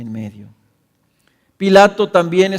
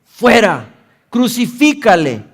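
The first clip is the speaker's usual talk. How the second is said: loud, rising, higher chant of the mob; crowd shouting; 5 semitones higher, 9 dB louder, slower than usual. A narrator's reading. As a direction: build the cry with each word. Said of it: "Entonces ellos gritaron".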